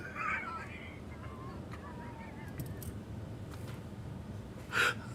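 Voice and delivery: long, soft, high wheezing laugh; laughter. Real, not acted. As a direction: crack up until it trails off into wheezes. Cracking up at own joke.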